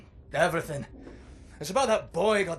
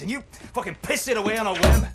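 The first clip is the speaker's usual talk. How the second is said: louder, harder accusing outburst; angry accusation; 5 semitones higher, 7 dB louder than usual. Acted. Old fighter blaming Blazkowicz for the boy, angry. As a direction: throw the blame at him.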